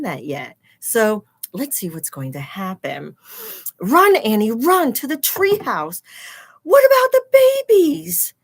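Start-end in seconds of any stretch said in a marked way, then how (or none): 3.8-6.0 loud urgent call to run; urgent shout. Acted. Teacher voicing characters in picture book. 6.6-8.3 high, loud worried cry; high panicked cry. Acted. Character worried about baby dinosaurs.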